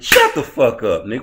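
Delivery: exasperated, forceful put-down; dismissive shout. Real, not acted. Snapping back at the quoted critics.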